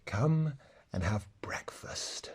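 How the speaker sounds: slow, hushed, gentle lamb; sweet milky lamb voice. A narrator's reading. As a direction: softly invite them to breakfast like a tender lamb. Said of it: "in its sweet, milky voice".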